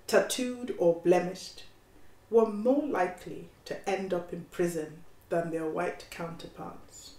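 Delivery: story narration. A narrator's reading. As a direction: narrate as the composed young lawyer. Reads her story aloud.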